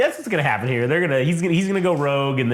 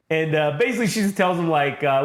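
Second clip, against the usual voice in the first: ordinary talk, nothing marked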